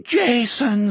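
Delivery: high, tearful sobbed name; sobbing. A narrator's reading. Old man at Jack's feet, sobbing with joy. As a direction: sob the name out.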